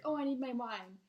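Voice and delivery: mock demand for wine; impatient customer, lightly acted. Impatient diner finds a server to demand wine, mock demanding.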